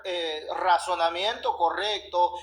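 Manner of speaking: ordinary talk, nothing marked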